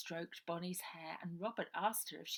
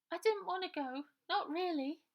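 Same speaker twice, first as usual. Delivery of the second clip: put-on much higher, small tearful girl's voice; little Bonnie; 12 semitones higher, 6 dB louder than usual. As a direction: plead like a guilty little sister. Bonnie sobbing into sister's shoulder.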